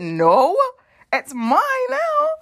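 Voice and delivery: slow, high, sassy "it's mine now"; cheeky refusal. Retold or imitated. Claiming the fifty she won't give back. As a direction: stretch it out high and smug.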